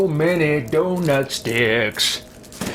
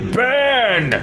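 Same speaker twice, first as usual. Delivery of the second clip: high, hammy spell shout; dramatic battle cry; 8 semitones higher than usual. Pretend. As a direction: yell it as you cast fire.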